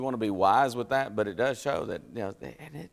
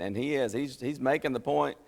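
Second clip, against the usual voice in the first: ordinary talk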